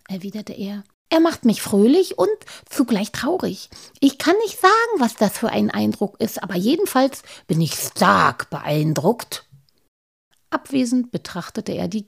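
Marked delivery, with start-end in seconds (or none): 1.1-9.5 higher, louder bashful gnome voice; a shy gnome owning up, voiced high; Halbschuh the gnome. Gnome admits to his friends his lack of taste, higher voice.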